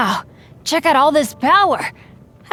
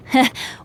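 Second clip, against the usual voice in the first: a short proud chuckle; happy laugh. Real, not acted. Adventurer to traveler, a proud little laugh.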